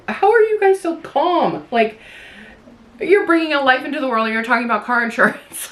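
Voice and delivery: amazed they can chat so calmly; incredulous. Retold or imitated. Amazed staff chat during birth.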